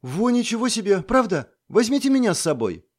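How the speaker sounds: put-on high eager girl, lit up. A narrator's reading. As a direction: beg to come along excitedly. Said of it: "загорелась девчонка". (загоревшись, с воодушевлением)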